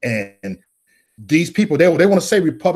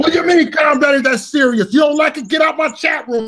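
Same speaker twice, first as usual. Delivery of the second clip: high, loud indignant shout; retold shouting; 10 semitones higher, 3 dB louder than usual. Answering chat room who said calm down, indignant shout. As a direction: yell it like you're fed up with your chat. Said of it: "And I said".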